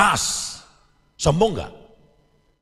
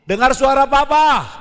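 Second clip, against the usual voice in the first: louder and higher call up the stairs; 8 semitones higher, 6 dB louder than usual. Retold or imitated. Father guides son down the stairs, loud call. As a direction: call it firmly so the child hears.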